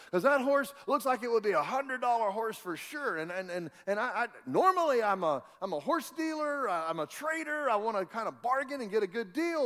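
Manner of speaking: normal talking voice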